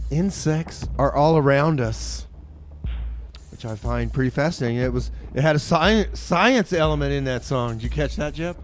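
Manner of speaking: ordinary delivery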